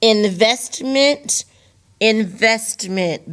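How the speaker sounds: investment said slowly, syllable by syllable; slow demo word. Shown as a demonstration. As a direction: spell out investment slowly for the class.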